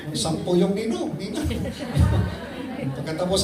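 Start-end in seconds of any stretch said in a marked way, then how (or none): 1.5-2.9 short laugh, higher pitched; amused laugh. Real, not acted. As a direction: laugh at your own joke. Laughs at ten godparents joke. (natatawang halakhak)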